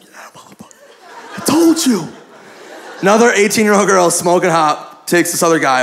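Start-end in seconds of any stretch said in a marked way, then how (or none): 0.0-0.7 hushed, whispered gloating; fired up. Retold or imitated. Friend led away by the girl, whispered excitement. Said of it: "He's all fired up". 1.3-2.1 high-pitched mock gloating; fired up. Friend led away by the girl, gloating. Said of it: "He's all fired up".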